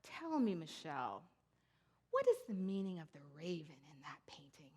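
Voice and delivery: deliberate, mock-suspicious questioning; woman's stern challenge. Suspicious woman confronts speaker about a painting, stern challenge. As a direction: imitate her pointed, measured demand. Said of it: "in her very strong accent, which I can't do".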